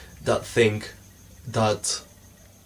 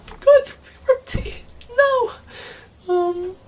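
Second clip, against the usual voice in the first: very high, louder, crying squeak; squeaky puberty voice; 4 dB louder, slower than usual. Pretend. Vlogger mock-panicking about puberty in a very high cry. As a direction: whine it in a cracked, squeaky voice.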